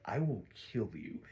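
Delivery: low, soft mock menace; deadpan threat. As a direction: act out a quiet, deadpan death threat. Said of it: "deadpanning the guards".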